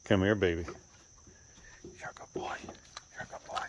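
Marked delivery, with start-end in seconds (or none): 1.8-3.6 very soft, voiceless whisper; whispered praise. Real, not acted. Owner praising a good boy, secretive whisper. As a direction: whisper it privately to the pet.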